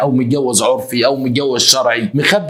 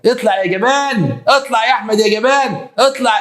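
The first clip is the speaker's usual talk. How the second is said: hammed-up indignant call, pitched way up; wronged wife calling out; 11 semitones higher than usual. Wife calls cowardly husband out of hiding, high indignant cry.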